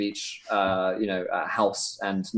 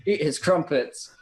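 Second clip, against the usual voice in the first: usual speaking voice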